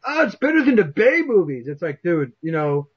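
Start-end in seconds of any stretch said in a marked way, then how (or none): 0.1-1.7 high, exaggerated fanboy imitation; mock commenter, pitched well up; mocking online commenters. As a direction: parrot the commenters in a silly high voice. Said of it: "hear from everyone else in the comments".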